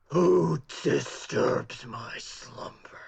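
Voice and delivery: slow, drawn-out monster boom, rough and whispery; loud booming voice. Acted. Woken guard challenges the intruders, loud and booming. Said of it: "in a loud, booming voice".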